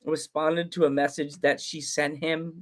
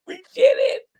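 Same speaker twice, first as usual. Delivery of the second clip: much higher, blubbering triumph; mock crying; 11 semitones higher than usual. Host as Tina, mock sobbing with joy over the booking. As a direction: sob it out like a happy cartoon girl.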